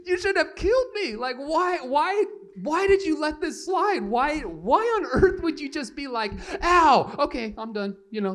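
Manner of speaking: high, loud, disbelieving rant; incredulous outburst. Retold or imitated. Son to mom after hitting her, loud incredulous rant. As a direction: rant in disbelief that she let it slide. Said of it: "I was like".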